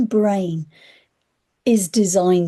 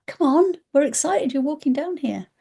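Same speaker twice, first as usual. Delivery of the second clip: higher, welcoming playful voice; cheery wind voice; 8 semitones higher than usual. Retold or imitated. Wind in the leaves encouraging the walker, higher and cheerful. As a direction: gasp then invite brightly. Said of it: "the wind in the leaves going".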